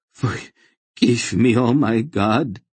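high, slow plea for forgiveness; begging. A narrator's reading. Collapsed zealot to his angry god, begging. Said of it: "he begged".

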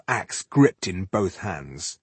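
ordinary delivery, nothing marked

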